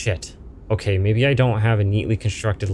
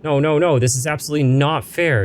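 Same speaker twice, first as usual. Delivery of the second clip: high, louder refusal; indignant protest; 7 semitones higher, 3 dB louder than usual. Acted. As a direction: push back hard and fast. Character protests loudly.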